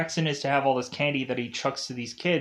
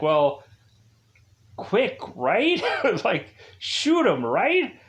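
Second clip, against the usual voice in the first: excited retold urging; 7 semitones higher, slower than usual. Paxton urging quick violent approach.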